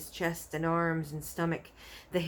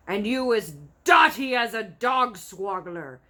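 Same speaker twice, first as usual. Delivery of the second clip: slow, loud giant shout; scornful giant yelling; cried; 4 semitones higher, 9 dB louder than usual. A narrator's reading. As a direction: shout him down. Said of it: "cried the blood bottler".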